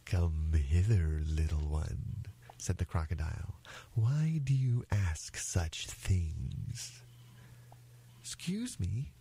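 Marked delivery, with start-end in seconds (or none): 0.1-2.2 slow, low crocodile voice; luring crocodile. Acted. Crocodile lures the little elephant closer, low and slow. 3.9-7.0 very slow, drawn-out crocodile question. Acted. Crocodile questions the little elephant, drawling slowly. 8.2-9.1 put-on small, soft, higher elephant's child; very polite. Elephant's child addresses the crocodile, softly polite. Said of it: "said the elephant's child most politely".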